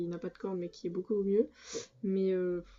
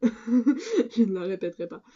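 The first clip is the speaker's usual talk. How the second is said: louder, drawn-out and laughing; laughing refusal; 2 semitones higher, 5 dB louder than usual. Real, not acted. Vlogger jokingly refuses viewers again, laughing and emphatic. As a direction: repeat it slowly and loudly through a laugh.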